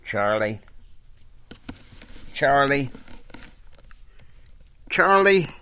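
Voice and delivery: building from plain to a loud call. Acted. Character calling out, building.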